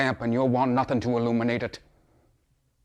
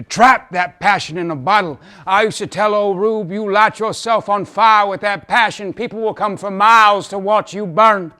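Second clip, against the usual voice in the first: high, loud, fired-up advice; fervent exhortation; 8 semitones higher, 10 dB louder than usual. Acted. Father recalling advice he gave his son, carried away and fervent. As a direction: exhort him at nearly a shout.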